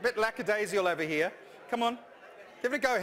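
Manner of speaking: ordinary talk, nothing marked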